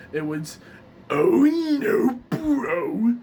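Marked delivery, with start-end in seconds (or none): 1.1-3.1 loud, high gravelly cry; Muscle Man. Shown as a demonstration. Showing viewers his learned line, gravelly Muscle Man voice. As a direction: force it out in a gravelly cartoon voice. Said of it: "when I learned Muscle Man".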